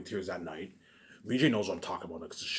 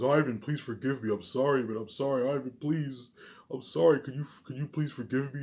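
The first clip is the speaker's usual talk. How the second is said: higher-pitched imitation of begging forgiveness; mock tearful pleading; 4 semitones higher than usual. Stepbrothers beg the speaker for forgiveness, mock tearful pleading. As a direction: plead in a raised voice, only half acting the tears. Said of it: "stepbrothers tearing up, breaking down tears".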